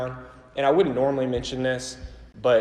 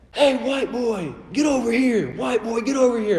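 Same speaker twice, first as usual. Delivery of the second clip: yelling across; 11 semitones higher, 4 dB louder than usual. Retold or imitated. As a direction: holler it at his back from a distance. Man yells from behind him.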